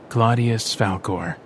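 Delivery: slow, measured reading aloud; slow deliberate recital; reading an inscription. Acted. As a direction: read the tomb's words slowly. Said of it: "Vincent read".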